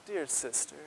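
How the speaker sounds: soft, gentle address; quiet, caring "Dear sister"; tender address. Shown as a demonstration. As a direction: say it softly, like comforting family. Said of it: "That's not the feel here. It's more like".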